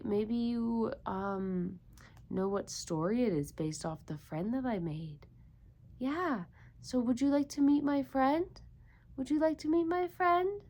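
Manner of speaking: ordinary delivery, nothing marked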